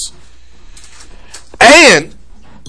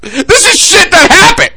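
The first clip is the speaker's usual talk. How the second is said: heated rant; 6 semitones higher than usual. Real, not acted. Co-host ranting on a podcast about a sports anchor, cracking with passion. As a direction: rant it like you're fed up.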